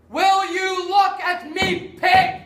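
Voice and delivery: loud, slow, contemptuous roar; bellowing at slave. Acted. Master roars at his slave to look at him, slow furious bellow. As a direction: bark it down at the servant.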